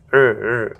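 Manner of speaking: "র" rolled as a strong curled r; American r sound. Shown as a demonstration. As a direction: curl the tongue on "র". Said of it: "এরকম করে রটা".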